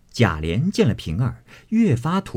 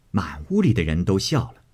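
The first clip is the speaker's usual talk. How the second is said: plain reading voice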